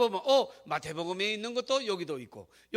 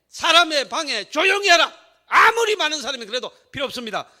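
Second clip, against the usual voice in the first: loud, raised-pitch sermon climax; full-force preaching; 5 semitones higher, 8 dB louder than usual. Real, not acted. Preacher urging congregation to trust God over people, forceful loud exhortation. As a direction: drive each command home to the congregation.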